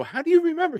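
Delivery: mock amazed. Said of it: "I go".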